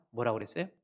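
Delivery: usual speaking voice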